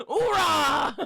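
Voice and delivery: loud laughing war cry; cheer. Real, not acted. Streamer cheering at the gifts, loud cheer. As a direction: whoop it out.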